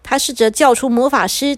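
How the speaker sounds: usual speaking voice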